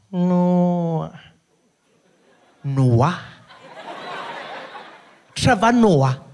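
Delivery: put-on high, loud accented drawl; a receptionist realising it is the comedian; mock recognition. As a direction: recognise him slowly, pleased. Nurse recognising him.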